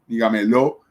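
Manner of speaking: "dígamelo" mal acentuado como "digameló"; acento mal puesto. Shown as a demonstration. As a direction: carga la fuerza en la LO final de "dígamelo". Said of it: "No decimos". ("dígamelo" dicho como palabra aguda, di-ga-me-LÓ)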